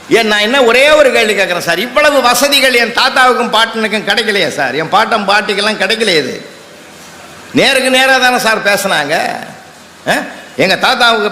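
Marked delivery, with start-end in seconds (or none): none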